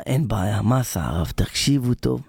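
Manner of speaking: ordinary delivery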